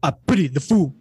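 put-on tough-guy catchphrase voice; Mister T. Co-host copies host's Mister T, put-on tough voice. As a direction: echo the tough-guy impression. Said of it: "what about Mister T?".

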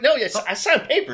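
high, loud, laughing protest; laughing disbelief. Retold or imitated. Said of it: "I was like".

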